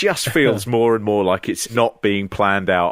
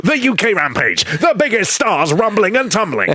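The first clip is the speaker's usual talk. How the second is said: upbeat hammed-up radio trailer; mock Capital Radio announcer, half laughing; radio promo voice; 5 semitones higher than usual. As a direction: sell it like a cheesy radio link. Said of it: "I'm gonna do one of those Capital Radio links".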